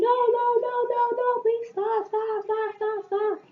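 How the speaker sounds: very high-pitched, retold frantic begging; panicked pleading. Girl begging two fighting friends to stop, panicked. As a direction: beg them to stop, high and repetitive.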